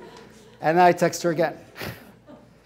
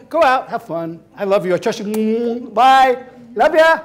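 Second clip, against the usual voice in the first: high, loud sing-song goodbyes; mock cheerful send-off; 4 semitones higher, 6 dB louder than usual. Boyfriend waving Lucy off, hammed sweetness. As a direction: overdo the supportive boyfriend.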